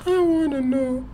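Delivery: very high, loud little plea; mock pleading. Friend begging for the school's name, mock pleading. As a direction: beg in a squeaky high put-on voice.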